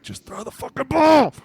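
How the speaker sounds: high, loud frustrated fan yell; exasperated yell. Retold or imitated. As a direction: scream at the quarterback from the couch. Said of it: "we're like".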